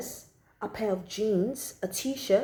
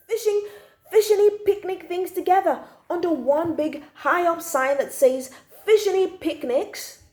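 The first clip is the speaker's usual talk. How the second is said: exasperated rant; 7 semitones higher than usual. A narrator's reading. Boy complaining to the reader about supermarkets, exasperated and higher. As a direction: whine it like a fed-up kid who knows better.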